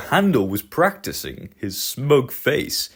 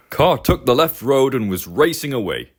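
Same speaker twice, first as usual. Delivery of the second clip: ordinary delivery, nothing marked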